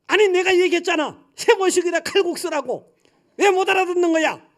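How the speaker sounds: very high, loud sharp retort; shrill nagging wife. Retold or imitated. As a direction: snap back at the husband. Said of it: "아주 앙칼진 여자 있잖아요". (남편에게 앙칼지게 쏘아붙이는 아내)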